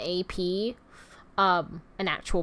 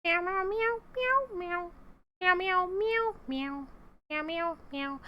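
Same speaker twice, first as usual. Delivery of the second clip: high, soft run of meows; cat meows; 10 semitones higher, 7 dB quieter than usual. Pretend. Killing time on a stream, high soft cat meowing. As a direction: mew quietly in a small high cat voice.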